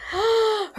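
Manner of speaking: high, quick gasp; acted gasp of surprise; shocked gasp. A narrator's reading. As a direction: gasp at a sudden discovery. Tooth spat into hand.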